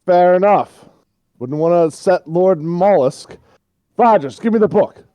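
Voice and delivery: high, loud mock compliance; shouted reply. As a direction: holler it down to the villain. Shouts back, then orders teammate.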